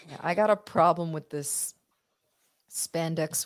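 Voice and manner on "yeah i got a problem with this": usual speaking voice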